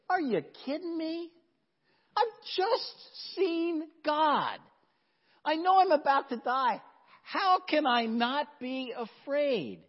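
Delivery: high, strained cry of a man sure he will die; Peter's panicked disbelief. Acted. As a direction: plead it high and desperate, like Peter seeing God. Preacher voices Peter's terror.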